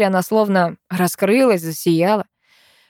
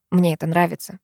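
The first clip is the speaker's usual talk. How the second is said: ordinary delivery, nothing marked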